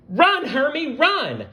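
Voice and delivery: high, loud frantic warning; panicked shout. Acted. Lucy the ladybug warning Hermie, panicked high shout. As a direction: yell it as you flee. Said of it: "Lucy shouted".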